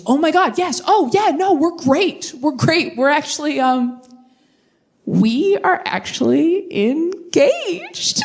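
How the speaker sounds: high, excited, stammering; bubbling over with the news; gushing. Retold or imitated. Courtney breaking her engagement news, gushing.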